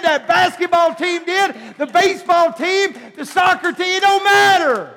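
shouting, high preaching climax; full-force preaching. Acted.

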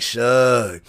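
low, understated voice; Moxley's real talk. Retold or imitated. Wrestler rolls up before the hotel, low imitated voice. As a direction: drop low for a laid-back greeting. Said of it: "That's how he really talk".

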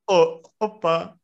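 mock sob, slow and drawn out; fake crying. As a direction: pretend to cry, hammed up. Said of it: "Estás a chorar?". (choro fingido)

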